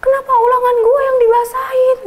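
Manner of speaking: high loud protest; retold fury. Student protesting to a friend about her soaked exam, high loud. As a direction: demand an answer, indignant.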